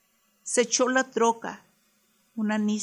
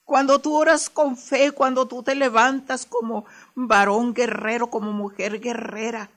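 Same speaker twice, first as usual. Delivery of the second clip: preaching at full force; 2 semitones higher, 7 dB louder than usual. Real, not acted. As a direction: shout it to the congregation. (predicando a todo pulmón)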